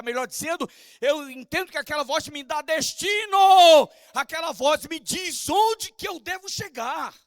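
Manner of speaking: rising pulpit fervor; fervent preaching. Acted. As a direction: drive the point home from the pulpit. Pastor preaching on apostolic voice.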